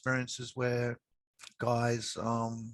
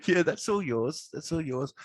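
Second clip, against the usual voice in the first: higher and louder, laughing through it tenderly; laughing reassurance; 6 semitones higher, 6 dB louder than usual. Retold or imitated. Adviser telling a client her savings are hers, laughing and higher. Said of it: "And I'm like".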